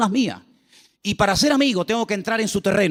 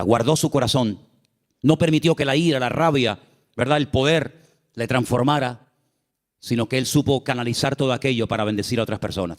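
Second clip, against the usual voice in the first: ordinary talk, nothing marked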